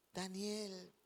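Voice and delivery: hushed, low, sorrowful call; sad voice. Acted. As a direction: call out softly and sadly, as the grieving king. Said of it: "llama a Daniel con voz triste". (voz triste, baja y apagada)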